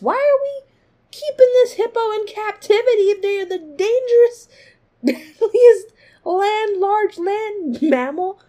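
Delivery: high, loud and slowed, half laughing; shocked outrage. Retold or imitated. Imagining reading the zoo sign, high shocked outburst. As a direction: drag out the outrage over the deadliest land mammal. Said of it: "I'd be like".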